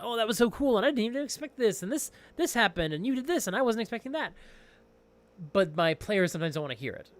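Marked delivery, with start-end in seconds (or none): 0.0-4.3 high, gushing rush of excitement; excited bragging. Retold or imitated. GM bragging to players about the session, high and gushing. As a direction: gush to the players about the twist. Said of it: "I wanna brag about".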